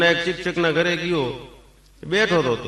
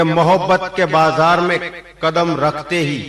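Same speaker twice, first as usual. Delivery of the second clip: shayari recital; 7 dB louder, slower than usual. Acted. Reciting his own shayari.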